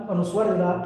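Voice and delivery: usual speaking voice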